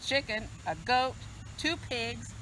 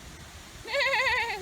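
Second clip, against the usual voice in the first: horse whinny. Acted. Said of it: "Said Horse".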